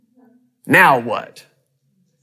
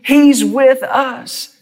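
voicing Caleb and Joshua; 8 semitones higher, 3 dB louder, much slower than usual. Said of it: "They said".

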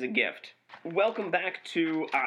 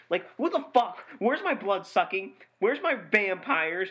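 exasperated, higher-pitched comic outrage; indignant rant; 4 semitones higher than usual. Acted. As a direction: demand it like a cheated customer. Mock outrage over missing blood sucking.

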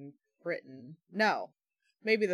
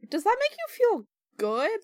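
incredulous, much higher and louder; outraged disbelief; 6 dB louder than usual. Retold or imitated. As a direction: fire the question back in disbelief. Outraged rhetorical question.

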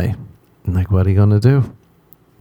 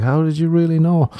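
upset friend, imitated; 8 semitones higher than usual. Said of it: "is upset and he's like".

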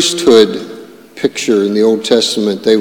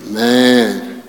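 normal talking voice

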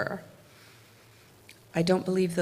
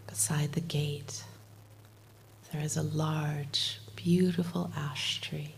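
guided meditation; 4 dB quieter than usual. Acted. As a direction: lead them gently into calm, half whispered. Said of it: "do a little bit of guided meditation".

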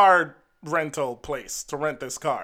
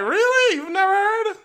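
put-on very high, shocked old lady voice; imitating an astonished old lady; mock astonishment. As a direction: gasp it out like she can't believe it. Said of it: "So like".

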